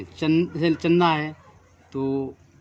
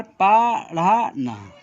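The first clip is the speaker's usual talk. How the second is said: slow demo; much slower than usual. Shown as a demonstration. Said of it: "ये ढ़ा है dark".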